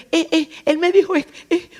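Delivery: high-pitched stammering hesitation; hesitant stalling. Retold or imitated. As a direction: imitate someone reluctant to break the news. Teller imitates man's hesitant reluctance.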